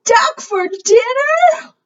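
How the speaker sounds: very high, slow squeal of disbelief; drawn-out high shriek of a question; shocked question. Acted. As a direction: echo it in squeaky mock horror. Shocked echo of the dog's announcement.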